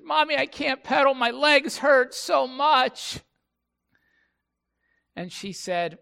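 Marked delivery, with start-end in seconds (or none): 0.0-3.2 put-on high, loud childish wail, drawn out at the end; mock kid's complaint, pitched way up; whining little kid. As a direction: imitate a tired child whining to mom. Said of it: "the younger of the two kids say".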